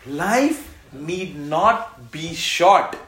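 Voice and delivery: proclaiming. Acted. Preacher to congregation, slow loud proclamation. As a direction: preach it out slowly to the room.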